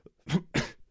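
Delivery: sharp cough on swallowed water; choking cough. Real, not acted. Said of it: "water that went down the wrong way".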